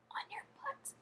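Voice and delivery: hushed, whispered retelling; imitated whisper. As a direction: whisper it like an awkward secret. Crush privately tells her, whispered.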